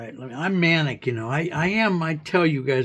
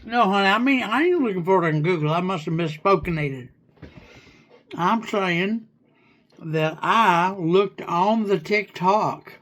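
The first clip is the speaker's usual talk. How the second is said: pretend hillbilly; 2 semitones higher than usual. Correcting the AI in a light hillbilly accent. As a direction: play a rambling country bumpkin, lightly. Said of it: "pretend hillbilly slang".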